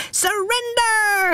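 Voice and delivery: put-on high brave child shout; little captain yell. A narrator's reading. Brave final demand.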